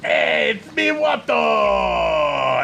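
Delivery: high, far-off nasal-free alien trader voice, shouting; Watto impression. Gamer doing Watto greeting Annie, hammed-up. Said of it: "I can't do a Watto voice".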